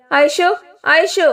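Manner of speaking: high, slow, drawn-out call down the stairs; calling loudly. A narrator's reading. Shahi calls his wife from upstairs, drawn-out and high. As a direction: call her name from upstairs, stretching it out. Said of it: "ഉറക്കെ വിളിച്ചു".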